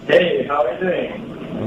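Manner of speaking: big hall echo; echoey room. Real, not acted. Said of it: "you sound very echoey out there".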